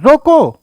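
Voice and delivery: ROKO "रोको" called high and loud, then dropping; stop command. Shown as a demonstration. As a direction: punch "रोको" as a firm order to halt. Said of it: "फिर कामा लिखा है".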